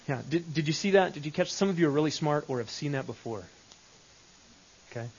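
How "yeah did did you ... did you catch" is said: ordinary talk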